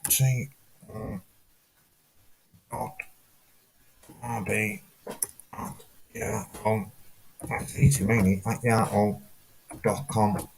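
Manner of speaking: soft, low and halting; reciting under the breath. Real, not acted. Caller going back over an email address, quietly.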